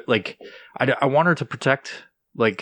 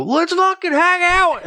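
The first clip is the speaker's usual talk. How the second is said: high, slow, goofy dog voice; cartoonish high pup voice; dog's voice; 5 dB louder, slower than usual. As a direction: voice the overeager dog in a silly falsetto. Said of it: "she'll run at another dog and go".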